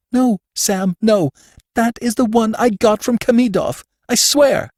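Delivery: high, frightened woman's plea; pleading cry. A narrator's reading. Woman to Sam, grabbed by the wrist, frightened high plea. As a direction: beg him to believe you, high and desperate. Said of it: "She cried".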